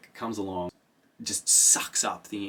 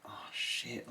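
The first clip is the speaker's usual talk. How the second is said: whispered dread; 3 semitones higher, 11 dB quieter than usual. Retold or imitated. Paul noticing the cracks.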